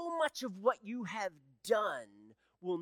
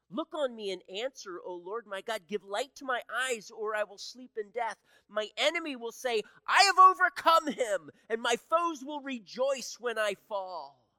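from earnest to loud, higher pleading; building scripture reading; 6 semitones higher, 9 dB louder than usual. Acted. Pastor reading the psalm's plea to God, building louder. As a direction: let the plea swell as you read.

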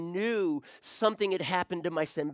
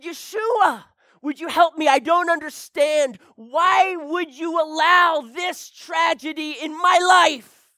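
loud, high, slowing desperate shout; angry plea; 11 semitones higher, 11 dB louder than usual. Retold or imitated. Father cries out to Yeshua over his dead daughter, shouting. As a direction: cry out to God, demanding why. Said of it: "And I was angry".

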